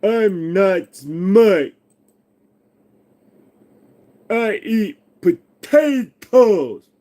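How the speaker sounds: slow, high goofy dimwit voice; dumb voice. Pretend. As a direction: drag it out like a dopey cartoon. Self-mocking dumb voice.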